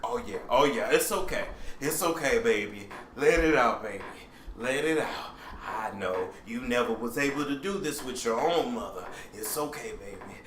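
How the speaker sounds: soft, low, cooing comfort; Iyanla impression. Imitating Iyanla soothing someone held to her bosom, softly. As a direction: hush them like a cradled child. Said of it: "She do 'em just like this".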